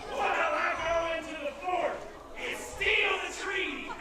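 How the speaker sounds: loud, laughing villain gloat. Acted. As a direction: gloat like a cackling crook. Villain gloats over switch.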